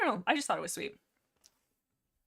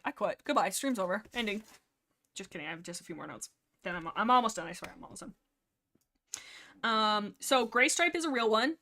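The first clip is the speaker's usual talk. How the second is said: ordinary talk, nothing marked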